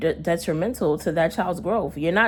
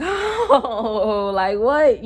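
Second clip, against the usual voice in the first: higher wailed "oh" with a laugh in it; mock crying; 5 semitones higher, much slower than usual. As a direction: imitate your own panicked crying, half laughing. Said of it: "I'm crying and shit".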